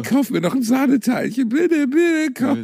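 very high, pleading wheedle; mock begging. Stoned man begging fans for pastries, mock begging. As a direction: beg comically in a high voice.

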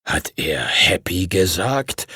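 a slow, low whisper, rising on "gesagt"; disbelieving whisper. Acted. Master asks in disbelief after Henry names his dragon, whispered. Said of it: "die ungläubige Stimme".

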